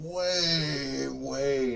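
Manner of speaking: WAY stretched out long, both times; long, swooping "way, way"; drawn-out emphasis. Real, not acted. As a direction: draw out each "way" for a big boast. Bragging how much better he rolls.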